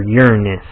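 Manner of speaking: Uranus said as "YUR-uh-nus"; alternate pronunciation. Shown as a demonstration. Player correcting a card's name, Uranus as "YUR-uh-nus". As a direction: stress the first syllable of Uranus, weak middle. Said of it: "how you pronounce that".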